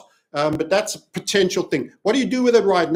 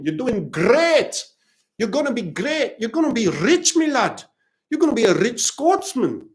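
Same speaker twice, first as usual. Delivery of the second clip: lightly put-on Scottish accent, higher; a hint of a cheery Scot telling you you'll be rich; 5 semitones higher than usual. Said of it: "how was the accent?".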